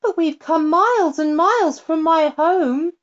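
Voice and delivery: lightly voiced girl, dismayed; little girl. A narrator's reading. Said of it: "cried the little girl".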